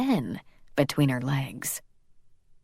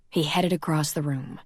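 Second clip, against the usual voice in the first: ordinary delivery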